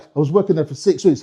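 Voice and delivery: ordinary talk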